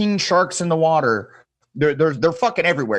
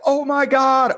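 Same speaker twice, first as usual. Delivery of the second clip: higher and louder, wide-eyed amazement; amazed; 11 semitones higher, 4 dB louder, slower than usual. Retold or imitated. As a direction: burst out in disbelief. Group reacts to second ride.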